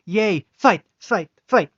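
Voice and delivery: high, loud giddy chant; gleeful cheering. Acted. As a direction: cheer the fight on giddily.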